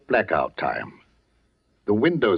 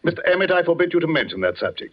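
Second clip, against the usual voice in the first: sharp, fast rebuke; 7 semitones higher, faster than usual. Acted. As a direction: snap the order out quickly. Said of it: "I spoke too sharply".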